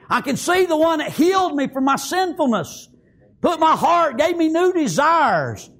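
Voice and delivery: high, sustained pulpit fervour; full-force preaching. Acted. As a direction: preach it in driving, high-pitched waves.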